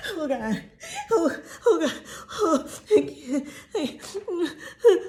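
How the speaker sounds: high, loud, hammed-up sobbing; mock wailing. Host imitates Tom crying out to God, melodramatic high wail. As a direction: cry out in exaggerated, gasping anguish. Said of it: "He's crying out to God".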